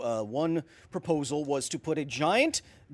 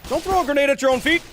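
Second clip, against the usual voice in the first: panicked yell; 13 semitones higher, 11 dB louder, much faster than usual. Real, not acted. Panicked yell mid-fight.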